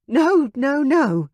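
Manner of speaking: high, loud urgent protest; panicked. Acted. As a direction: stop him before he changes his mind. Veronica panics, stops him.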